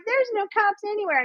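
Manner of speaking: sneering high quote; mocking ditzy woman. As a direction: keep mimicking her as an airhead. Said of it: "And I was like".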